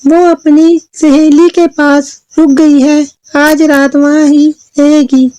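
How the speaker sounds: high-pitched storybook daughter; Chulbul. Acted. Daughter covering for her missing sister, high explaining voice. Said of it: "चुलबुल ने अपनी माँ को बताया".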